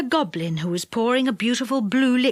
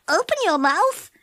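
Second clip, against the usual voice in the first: shout; 6 semitones higher than usual. A narrator's reading.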